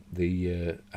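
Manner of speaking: ordinary talk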